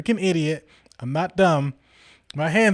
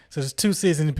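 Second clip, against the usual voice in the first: plain reading voice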